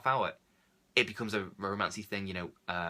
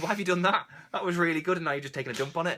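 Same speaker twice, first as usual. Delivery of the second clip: higher and louder, mock outrage at a ruined picture; bursting out in disbelief, speeding up at the end; exasperated outburst; 6 semitones higher, 5 dB louder than usual. Viewer protesting to the director, in mock exasperation. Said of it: "And you think".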